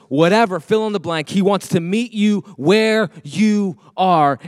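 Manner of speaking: loud, emphatic sermon build; fervent preaching. Acted. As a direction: drive the point home to the church. Preaching at full force.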